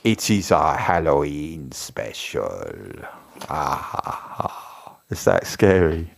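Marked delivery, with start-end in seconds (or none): 0.0-2.7 slow, hammed-up spooky host; put-on creepy drawl; scary Halloween voice. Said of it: "That was my scariest, scary voice". 3.5-5.0 low, slow spooky laugh; soft breathy "ah, ha, ha" fading away; hammed-up villain chuckle; mock scary laugh. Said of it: "That was my scariest, scary voice".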